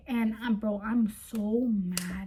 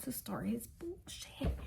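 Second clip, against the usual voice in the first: hushed, breathy aside; whisper; 12 dB quieter than usual. Real, not acted. Telling viewers about his tantrum, whispered aside.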